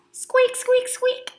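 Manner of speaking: high squeak, squeak; squeaky shoe noise. Retold or imitated. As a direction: squeak it like wet shoes. Imitating squeaky shoes.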